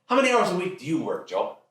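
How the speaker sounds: fast, high-pitched father impression; interrogating dad. Dad grilling teen about hours, rapid and insistent. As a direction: rattle off the question as dad.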